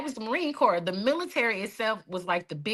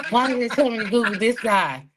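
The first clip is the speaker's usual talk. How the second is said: laughing; 4 dB louder than usual. Real, not acted. Laughing at googling results.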